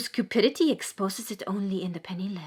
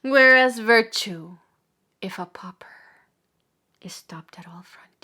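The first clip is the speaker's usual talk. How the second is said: falling to hush; 6 dB louder than usual. Acted. Preacher lamenting virtue stopped at frontiers, fading into hush. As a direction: start strong, then drop almost to nothing. Second take, drops to a hush.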